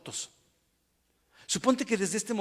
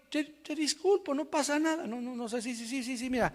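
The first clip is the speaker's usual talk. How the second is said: soft, high, hurried brushing-off; mock dismissive pardon; 9 semitones higher, 4 dB quieter than usual. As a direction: wave away the offence in mock leniency. Imita a quien disculpa sin más. (disculpa fingida, aguda y restándole importancia)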